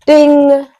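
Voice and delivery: high, loud "Ding" like a notification; mock notification ding; phone ding. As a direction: chime it out like a phone alert. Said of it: "put another ding there".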